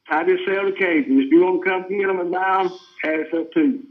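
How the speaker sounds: ordinary talk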